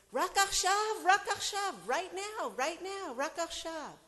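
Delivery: high, loud desperate cry; shouting. Retold or imitated. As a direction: shout it in chains, pleading. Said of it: "shouting with his chains".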